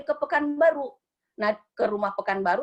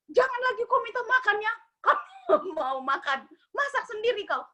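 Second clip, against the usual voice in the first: high mock scolding; 11 semitones higher than usual. Maid quoting dream scolding.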